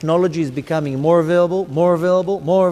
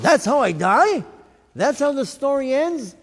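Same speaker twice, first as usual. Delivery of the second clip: stunned disbelief; 10 semitones higher than usual. Retold or imitated. Retold shock at own death.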